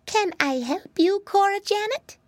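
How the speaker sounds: put-on high, courteous piggy-boy offer; most polite. A narrator's reading. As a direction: offer help politely in a little pig's voice. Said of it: "said most politely".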